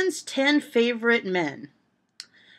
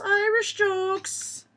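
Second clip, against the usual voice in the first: put-on slow Irish accent, pitched much higher; 11 semitones higher, much slower than usual. Repeating the heading higher in a mock Irish accent. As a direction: sing it out in a high mock Irish lilt. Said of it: "doing it in an Irish accent".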